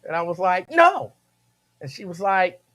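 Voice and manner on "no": put-on high, loud little-kid "no"; protesting child. Kid answering mama's question, high loud protest. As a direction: blurt it like a kid, pitched way up.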